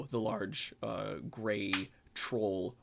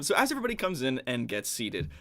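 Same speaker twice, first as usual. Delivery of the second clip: usual speaking voice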